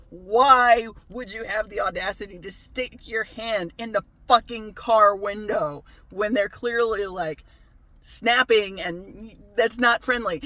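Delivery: unload on the idiot who reached into the car; retold tirade at a stranger who reached in to pet the puppy; furious rant. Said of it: "I'm, like, livid, and it's like".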